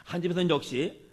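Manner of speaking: normal talking voice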